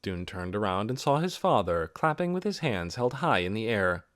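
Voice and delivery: usual speaking voice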